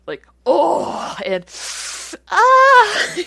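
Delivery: high, loud fake porn moans; hammed-up fake moaning; mock porn moan.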